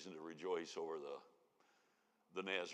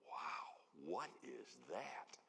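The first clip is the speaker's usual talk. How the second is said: hushed wonder; 5 semitones lower, 8 dB quieter, much slower than usual. Retold or imitated. Guests smelling the opened oil.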